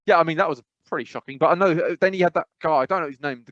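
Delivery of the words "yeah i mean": ordinary delivery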